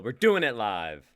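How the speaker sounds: hyping the group up, loud; loud announcement. Real, not acted. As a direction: call it out to the group. Announcing the live reading.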